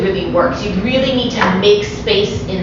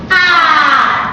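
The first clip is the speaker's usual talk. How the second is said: big loud open ah; 7 semitones higher, 5 dB louder than usual. Shown as a demonstration. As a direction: belt a wide open ah. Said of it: "So give it a ah".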